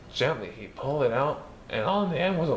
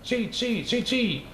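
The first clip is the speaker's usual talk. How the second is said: high mechanical chee chee, higher pitch; grinder noise; 9 semitones higher, slower than usual. Acted. Narrator mimics the mill's grinder, high and mechanical. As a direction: imitate a flour mill grinding, pitched up.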